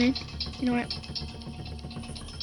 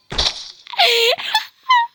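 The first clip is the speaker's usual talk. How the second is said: crying laugh; 13 semitones higher, 4 dB louder than usual. Real, not acted. Jump scare from Granny.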